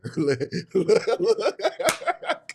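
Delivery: long, rising belly laugh; real laughter. Real, not acted. Coach cracking up over the million-dollar salary, laughing. Laughs at his own aside.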